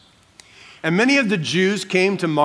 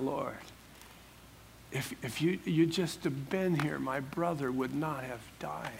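hushed, near tears, much softer; broken heart; 13 dB quieter than usual. Shown as a demonstration. Preacher voicing grieving Martha to Jesus, soft and tearful. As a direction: let the grief break it quietly. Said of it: "saying it through tears and a broken heart".

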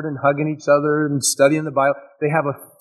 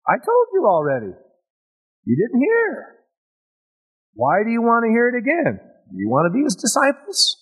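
high, fast sarcastic retort; 9 semitones higher than usual. Acted. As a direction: needle them with mock patience. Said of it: "begins to get a little sarcastic".

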